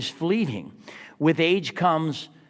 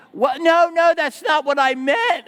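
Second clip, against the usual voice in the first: high, louder flustered denial; a student scrambling to take it back; hammed-up panic; flustered backpedal; 10 semitones higher, 6 dB louder than usual.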